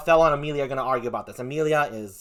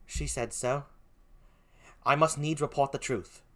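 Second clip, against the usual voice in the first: put-on poor British accent; 6 dB quieter than usual. Emilia must report the truth, quiet British accent. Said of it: "forgive my poor British accent".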